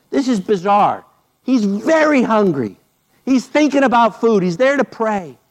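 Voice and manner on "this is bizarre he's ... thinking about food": ordinary talk, nothing marked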